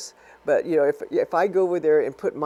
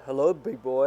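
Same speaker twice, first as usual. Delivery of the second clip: sexy hotline voice; 2 semitones lower than usual. Acted. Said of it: "the one eight hundred numbers".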